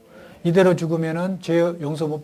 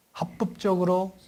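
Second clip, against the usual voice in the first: normal talking voice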